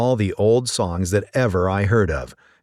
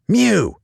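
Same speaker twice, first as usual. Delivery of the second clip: loud high meow; sharp cat yowl; angry mew; 13 semitones higher, 5 dB louder than usual. Acted. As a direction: yowl at the window. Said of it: "scuffled Simpkin".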